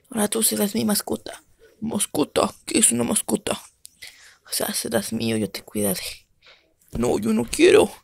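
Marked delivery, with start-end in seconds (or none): 4.4-6.0 much lower, softer hushed voice; low soft whisper; deep whisper. Acted. Creator whispers ownership.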